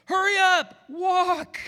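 very high, loud squeaky yell; comic frantic urging to hurry; shrill mock shout. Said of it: "it's like".

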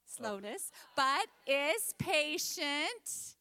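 slow, measured scripture recitation; group recitation. Real, not acted. Teacher leading children in a verse, slow, deliberate recitation. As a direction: stretch each word so others can follow. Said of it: "let's say it all together".

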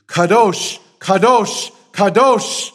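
the seraphim crying Kadosh to one another; emphatic triplet. Acted. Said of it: "the emphatic Semitic triplet".

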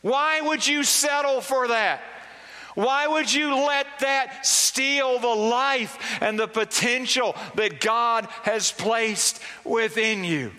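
high-pitched whiny complaining, imitating a grumbler; mock whiny voice, pitched well up; whining. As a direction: whine it out like a chronic complainer. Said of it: "can't even say that word whining without it feeling like whining".

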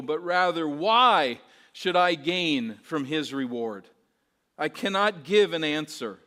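loud, high, impassioned preaching; preaching at full force. Real, not acted. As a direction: proclaim it over the congregation.

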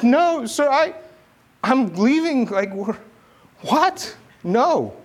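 high, loud, disbelieving "No, sir"; refuse, baffled and exasperated; incredulous refusal. Retold or imitated. Retold refusal to the commander.

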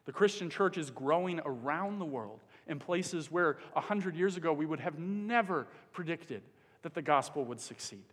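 ordinary delivery

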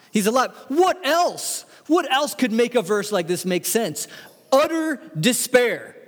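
high, ringing sermon climax; impassioned preacher's triumphant peak; full-force preaching. Real, not acted. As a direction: proclaim it, pitch soaring.

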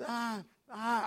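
acting out a man stalling for words; hesitating. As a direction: hem and haw. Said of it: "he was hesitating a little bit".

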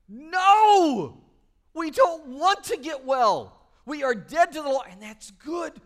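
loud, high, emphatic refusal; full-force preacher rejecting legalism; preaching. Acted. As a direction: hammer it home from the pulpit. Preacher rebutting teachers.

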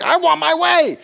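loud, high-pitched mock tantrum; childish demand. Preacher voicing children's unsophisticated inner voice, loud childish demand. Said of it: "you don't have that sophistication. You're just like".

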